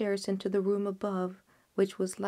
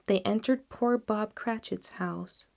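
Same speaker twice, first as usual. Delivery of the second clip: plain reading voice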